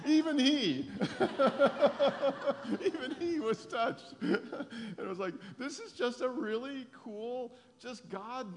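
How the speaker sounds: high, loud, delighted laughing through the words. Real, not acted. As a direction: laugh it out, overjoyed and moved. Telling a touching moment, laughing.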